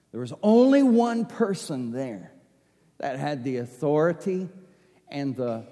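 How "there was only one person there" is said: normal talking voice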